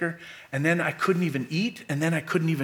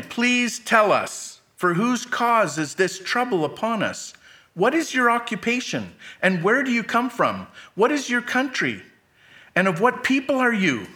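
anxious sailors; 7 semitones higher than usual. Acted. Frightened sailors question Jonah.

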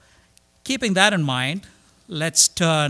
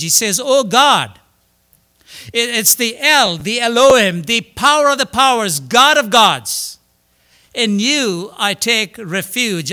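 louder, higher preaching at full force; fiery preaching; 4 semitones higher, 5 dB louder than usual. Acted. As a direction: lift the room, preach it out strong.

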